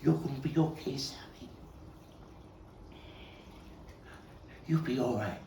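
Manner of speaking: holding a dying friend, begging him to stay; desperate comfort to a dying friend. Acted. Cradling dying friend in his arms.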